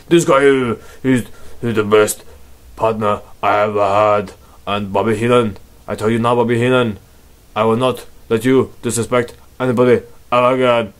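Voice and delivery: lumbering giant's speech ending in a heavy laugh; Andre the Giant. Acted. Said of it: "That's my Andre the Giant impression".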